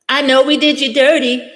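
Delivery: high-pitched mock-cutesy read, imitating the writer; cutesy tweet voice. Vlogger reading a stranger's tweet to her chat, mock-cutesy. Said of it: "writing this little cutesy thing".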